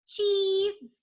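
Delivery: cheese sung up high; playful hint. Shown as a demonstration. Hinting the magic word to the children, high teasing cheese. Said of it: "Magic word rhymes with this".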